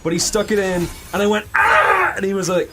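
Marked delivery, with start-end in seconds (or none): 1.5-2.1 loud, high mock scream; retold pain scream. Patient when doctor hit a nerve, mock scream. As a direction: imitate a sudden yell of agony.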